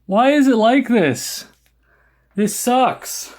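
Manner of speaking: high-pitched exasperated whine; whiny frustration. Real, not acted. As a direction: plead with the machine, fed up. Frustrated pleading at hardware.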